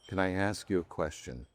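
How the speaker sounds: hushed earnest question. Retold or imitated. Billy Graham grips his hand to ask, hushed. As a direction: ask quietly, as if something weighty follows. Retelling a solemn private moment.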